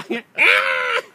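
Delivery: rire aigu qui éclate; gros éclat de rire. Real, not acted. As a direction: rire fort, incontrôlable. Rires pendant la lecture sensuelle. (éclat de rire franc)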